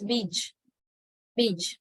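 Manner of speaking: "beej" with long ee and final j; model pronunciation. Shown as a demonstration. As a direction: demonstrate "beej", long ee, ending in j. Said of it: "pronounced as".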